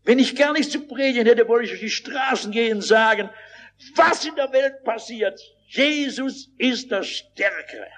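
high, emphatic proclamation; preaching. Real, not acted. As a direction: proclaim it as street-preacher news. Proclaims his core message.

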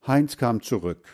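ordinary delivery, nothing marked

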